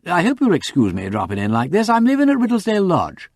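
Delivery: apologetic gentleman caller, rising high at the end; lightly voiced polite visitor. A narrator's reading. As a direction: excuse yourself courteously for dropping in. Wimsey introduces himself.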